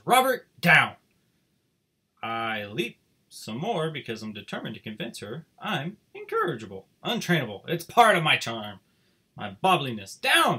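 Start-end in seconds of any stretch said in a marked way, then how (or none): 0.0-0.9 sharp, loud stern owner; loud, stern "Robert, down!"; stern command. A narrator's reading. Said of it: "looks at me sternly and says". 10.2-10.6 high, loud sharp "Down"; repeated firm command; stern command. A narrator's reading. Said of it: "she says again".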